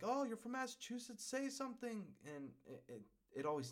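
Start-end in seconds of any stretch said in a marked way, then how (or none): 0.0-2.0 much higher, bright mock-excited fan voice; mock eager Californians. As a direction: imitate people begging for the accent. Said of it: "people are like".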